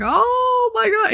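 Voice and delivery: imitating a thrilled husband, very high and drawn out; slow, high gasp of joy, hammed up; delighted squeal. Husband seeing the barbecue sauce, imitated delighted squeal.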